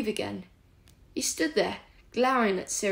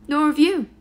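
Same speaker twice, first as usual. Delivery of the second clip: retort; 6 semitones higher, 9 dB louder than usual. A narrator's reading. Sirius retorting.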